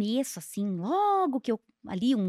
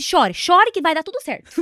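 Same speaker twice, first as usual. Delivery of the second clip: loud, higher mock urging; sarcastic encouragement; 5 semitones higher, 9 dB louder than usual. Mother to her crying daughter, loud sarcastic encouragement. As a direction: egg her on sarcastically, loudly.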